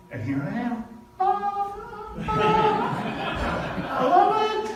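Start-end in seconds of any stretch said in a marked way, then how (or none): 0.9-3.8 gleeful high-pitched laugh; high joyful laugh. Real, not acted. Joyful laugh about speaking again. 3.9-4.7 high, laughing I love it; laughing with delight. Real, not acted. Delighted about speaking again.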